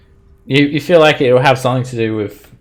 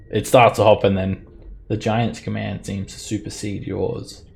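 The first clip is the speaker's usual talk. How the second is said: ordinary talk, nothing marked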